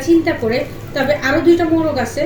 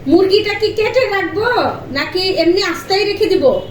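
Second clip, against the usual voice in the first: calling out; 6 semitones higher than usual. Real, not acted. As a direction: call out to show how she calls. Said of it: "দেখুন আমি কয়টা ডাক দিই".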